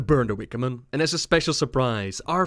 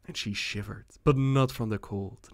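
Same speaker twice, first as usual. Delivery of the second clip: plain reading voice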